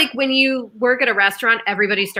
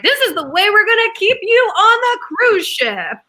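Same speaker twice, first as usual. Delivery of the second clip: mock scheming bosses; 10 semitones higher, 4 dB louder than usual. Voicing the cruise line bosses.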